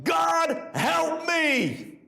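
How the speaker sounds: desperate scream. Retold or imitated. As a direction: cry out to God in panic. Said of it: "that I screamed".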